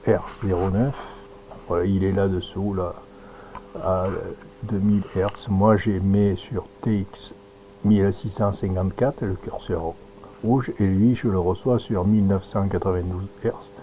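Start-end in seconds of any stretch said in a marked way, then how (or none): none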